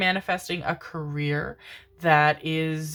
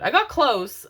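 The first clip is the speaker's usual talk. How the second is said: louder and higher, proud insistence; emphatic; 8 semitones higher, 7 dB louder than usual. Real, not acted. Mom telling viewers about her duck voice, emphatic. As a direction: punch "I got close" with pride. Retelling failed Donald Duck voice.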